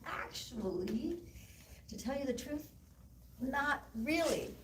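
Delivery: imitating a sheepish son owning up; high, drawn-out, hesitant confession. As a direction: confess to mom sheepishly, stalling between phrases. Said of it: "And sheepishly, he's like".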